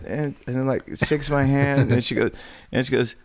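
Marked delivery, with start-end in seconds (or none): none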